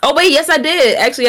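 normal talking voice

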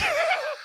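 squeaky burst of laughter; sudden high giggle. Real, not acted. Laughter after joke.

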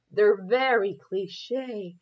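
slow, drawn-out mock dramatic reading of "They're very cliche"; overly dramatic. Shown as a demonstration. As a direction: ham up "They're very cliche" like italic prose. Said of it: "let me say it in overly dramatic".

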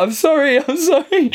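very high, pleading fake sob; mock crying. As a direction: play up a tearful apology.

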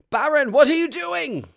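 very high, loud yell; alarmed shout. Acted. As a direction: yell at a comrade who has stopped mid-retreat. Alarmed shout at halted comrade.

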